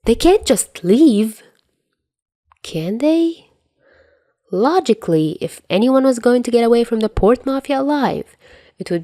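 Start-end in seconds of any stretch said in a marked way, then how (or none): 0.0-3.5 stunned disbelief. Acted. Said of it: "Dazai gapes".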